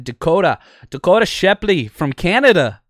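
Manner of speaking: put-on a podcaster's deliberately terrible Canadian accent on a player's name; bad Canadian accent. Host jokingly announcing a Canadian signing in a bad Canadian accent. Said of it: "that was the worst Canadian accent ever".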